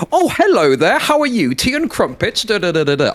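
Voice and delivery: very high, exaggerated British accent; mock British accent. Mimicking Americans mocking Brits on this platform, mock British accent. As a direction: put on a squeaky, hammed-up British accent. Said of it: "American dickheads being like".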